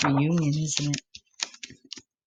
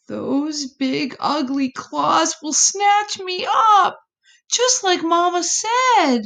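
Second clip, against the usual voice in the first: put-on scared child; 10 semitones higher, 4 dB louder than usual. Frightened possum child speaks.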